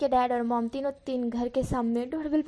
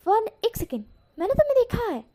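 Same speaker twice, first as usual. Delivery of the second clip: sudden recognition; 9 semitones higher, 4 dB louder, faster than usual. A narrator's reading. Aunt suddenly recognizes nephew.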